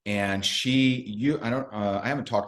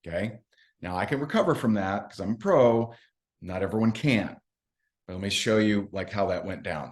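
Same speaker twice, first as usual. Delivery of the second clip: ordinary delivery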